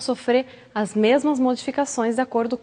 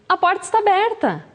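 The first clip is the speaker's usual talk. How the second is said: inviting, higher; 6 semitones higher than usual. Shown as a demonstration. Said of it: "a entonação também muda".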